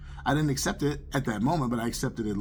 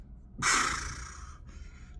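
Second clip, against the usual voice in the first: heavy sigh; 6 dB quieter than usual. Retold or imitated. Man told to forgive his dad, sighing heavily. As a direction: blow out a long reluctant breath.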